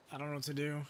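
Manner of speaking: ordinary delivery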